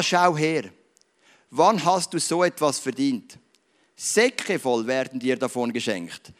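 put-on slow, measured reading from a children's history book; story read aloud. A narrator's reading. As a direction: read the tale aloud to the congregation, unhurried. Beispiel vorlesen aus diesen Büchlein.

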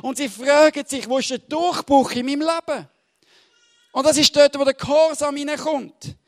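loud, high, fervent sermon delivery; full-force preaching. Acted. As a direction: preach it out to the whole hall.

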